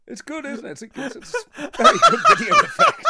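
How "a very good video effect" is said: high, loud, laughing through it; cracking up mid-sentence. Real, not acted. Laughing at the video.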